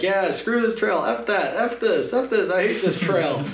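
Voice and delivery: high-pitched re-enacted griping; hammed-up string of complaints; mock swearing rant. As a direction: curse the trail in a comic high voice. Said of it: "I start swearing at the trail".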